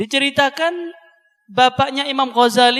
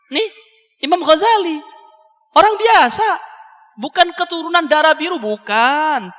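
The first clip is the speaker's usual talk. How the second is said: fervent preaching; 5 semitones higher than usual. Acted. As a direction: rouse the congregation with fervour. Preaching with rising fervour. (khotbah berapi-api dengan suara tinggi)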